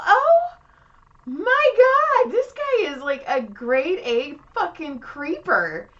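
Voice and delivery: high, loud, horrified, dragging the last words slowly; horrified exclaim. Retold or imitated. Reacting to the client who asks about her books, a high horrified shriek. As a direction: shriek it in disgusted shock.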